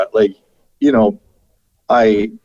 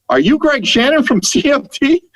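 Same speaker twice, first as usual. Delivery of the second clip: high, thrilled fan blurting his name; a stranger thrilled to recognize the radio host; excited fan; 12 semitones higher than usual. Retold or imitated. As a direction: gush like a starstruck fan spotting him. Fan recognizes him on street.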